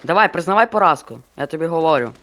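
ordinary talk, nothing marked

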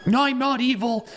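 very high falsetto protest; squeaky accused character. Pretend. Player voicing accused Mary's denial, squeaky falsetto.

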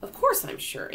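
put-on high, boyish assurance; confident reassurance. James answers the doubting ladybug, confident reassurance.

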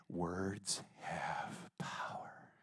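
slow, hushed whisper. Real, not acted. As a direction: let the truth sink in.

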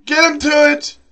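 high, urgent shouting; frantic yelling. Real, not acted. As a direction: yell for the kill in a panic. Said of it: "Let me calm down".

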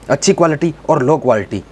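ordinary talk, nothing marked

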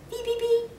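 high chirpy beeps like a little bird; high mock bird beeps; chickadee chirp. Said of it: "a chickadee whistled".

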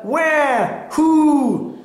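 high wail, then long hoot; seal calls. A narrator's reading. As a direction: imitate two seals calling. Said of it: "said the seals".

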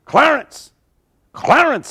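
high, calling out to the dead; preacher calling the dead man by name; yelled name. Retold or imitated. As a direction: call his name across the room, high and ringing. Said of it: "He just yelled at the corpse".

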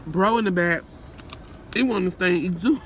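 ordinary talk

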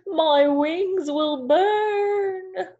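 very slow, high, wailing cry; crying owl. Acted. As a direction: wail it like a panicked owl. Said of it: "cried Owl".

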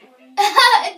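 bursting out laughing; big loud burst of laughter. Real, not acted. Sister refuses the challenge.

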